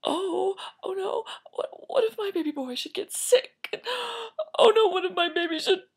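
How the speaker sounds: high worried whimper building to a loud wail; hammed-up sob, rising to a howl; mock crying. Girl fearing her imagined baby dies, mock wailing. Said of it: "So she cried and she cried".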